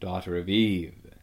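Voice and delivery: low, solemn lion's voice; grave. A narrator's reading. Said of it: "said Aslan in a graver voice".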